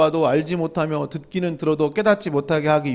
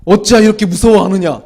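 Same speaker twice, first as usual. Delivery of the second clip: rebuking; 10 dB louder than usual. Acted. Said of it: "혼내".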